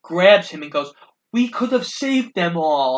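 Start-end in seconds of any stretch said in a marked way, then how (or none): 1.3-3.0 mock angry outburst, raised pitch, drawn out; lightly hammed-up accusation; retold angry reproach. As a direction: imitate a furious father, slow and higher. Retelling father confronting the asshole.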